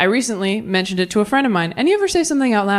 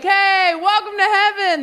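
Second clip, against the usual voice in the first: God greeting; 10 semitones higher than usual. Retold or imitated. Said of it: "you're like".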